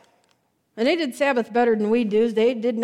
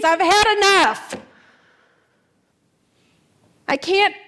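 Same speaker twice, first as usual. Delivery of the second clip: high, loud desperate plea building to a wail; begging through grief, at the end of her rope; anguished pleading; 5 semitones higher, 5 dB louder than usual. Retold or imitated. Mary pleading with the gardener, anguished and loud. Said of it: "she's crying and carrying on".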